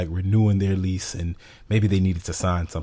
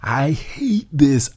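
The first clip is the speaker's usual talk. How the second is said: mock exasperation; 6 semitones higher, much slower than usual. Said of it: "I was like".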